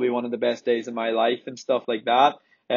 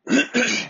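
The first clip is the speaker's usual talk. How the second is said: quick cough to clear throat; short harsh throat clear; 11 semitones higher than usual. Real, not acted. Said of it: "Sorry, I'm coughing a little bit".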